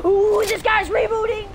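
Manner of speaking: high, loud siren-like cry; shrieking game announcer voice; reboot van siren. Retold or imitated. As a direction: blare it like an alarm going off. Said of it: "the reboot van is a freaking air raid siren".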